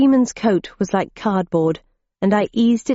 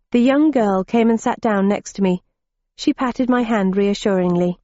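plain reading voice

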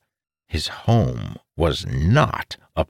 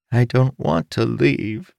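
high, slow sorrowful line; sad young son; 8 semitones higher than usual. Acted. Lonely son reluctant to leave home, sad and slow. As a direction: say it as a lost child.

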